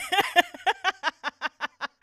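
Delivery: high, loud burst of laughter; helpless high laugh. Real, not acted. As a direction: crack up at your own story. Laughs at own screamed quote.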